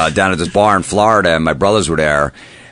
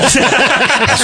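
laughing. Real, not acted. Laughing while telling the story.

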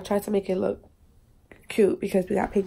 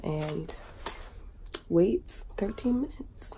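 whispered trailing; 2 semitones lower, 6 dB quieter, slower than usual. Real, not acted.

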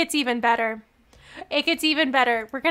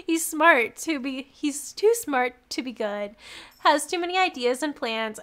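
normal talking voice

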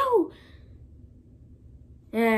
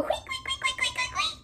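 very high, quick piercing squeals; squeaky little rodent impression; guinea pig squeaks; much slower than usual. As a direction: imitate squeaking guinea pigs, last one drawn out. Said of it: "They're going".

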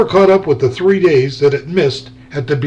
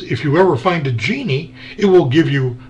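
plain reading voice

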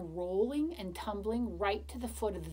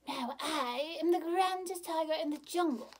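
higher, proud tiger voice; boasting tiger; 6 semitones higher than usual. Acted. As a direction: strut and brag. Tiger boasts going away.

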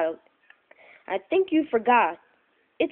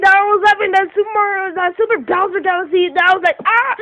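excited rambling outro; 8 semitones higher, 6 dB louder than usual. Real, not acted.

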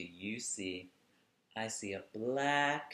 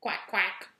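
higher, louder quacking; duck quacks; 10 semitones higher, 6 dB louder than usual. Pretend. Host reading to kids, quacking like the yellow duck. Making the duck's sound.

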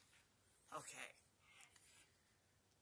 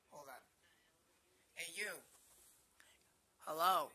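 ordinary talk, nothing marked

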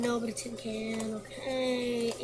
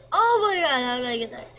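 astonished; 2 semitones higher, 11 dB louder, much faster than usual. Real, not acted. Player amazed she got it right, loud surprised. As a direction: blurt it out in disbelief.

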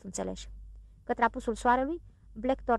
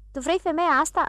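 higher, louder surprised question; 7 semitones higher, 7 dB louder than usual. A narrator's reading. Village elder asking Blackthorn about the naked woman, surprised. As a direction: ask in plain disbelief. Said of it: "întrebă el foarte surprins".

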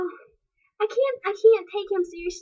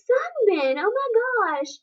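delighted gasp; 8 semitones higher than usual. Retold or imitated. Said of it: "And I was like".

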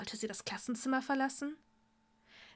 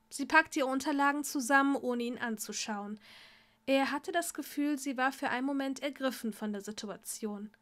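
ordinary delivery

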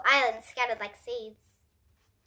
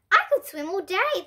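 cried out; 7 semitones higher, 5 dB louder than usual. A narrator's reading. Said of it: "the dad cried".

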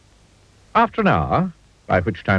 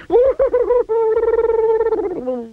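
high frightened wail. A narrator's reading. Mister Jelly wails.

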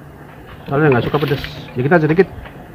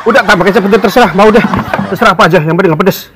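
gasping through the heat; words squeezed out past burning chili; mouth burning from spicy food; 4 semitones higher than usual. Real, not acted. Eating very spicy noodles.

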